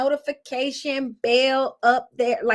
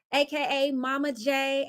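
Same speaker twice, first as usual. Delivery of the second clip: ordinary talk, nothing marked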